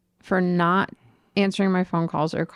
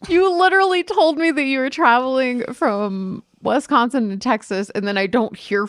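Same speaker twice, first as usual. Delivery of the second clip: raised, exasperated telling-off; retold rant; 4 semitones higher, 6 dB louder than usual. Retelling how she scolded her friend, raised and exasperated. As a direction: let the frustration build. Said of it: "I was like".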